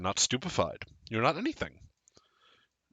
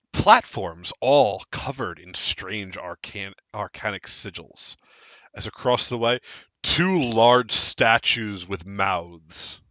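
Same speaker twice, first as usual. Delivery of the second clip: scene narration; 3 semitones lower than usual. Acted. Game master narrates the scene.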